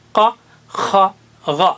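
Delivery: teacher showing the throat letters. Shown as a demonstration. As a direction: push قا، خا، غا from the back of the throat. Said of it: "قاف ادھر ہے".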